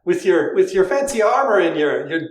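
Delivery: put-on a light Irish accent, high and laughing; Irish accent, amused. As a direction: play a gruff Irish ferryman. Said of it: "You do the Irish voice".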